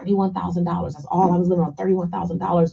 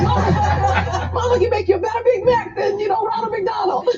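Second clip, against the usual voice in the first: much higher, laughing, bursting into a shout at the end; laughing mom; 11 semitones higher, 3 dB louder than usual. Retold or imitated. Mom telling her son about homemade Big Macs, laughing and high. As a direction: tease the kid through laughter. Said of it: "I was like".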